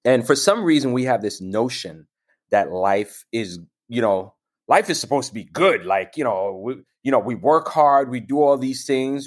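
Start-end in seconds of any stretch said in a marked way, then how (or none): none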